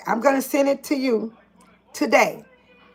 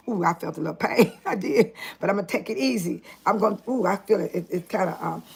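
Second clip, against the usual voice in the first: usual speaking voice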